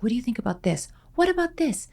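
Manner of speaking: high, hushed playful asking voice; light imitation of herself pestering with questions; mock eager questions. Quoting her own eager questions.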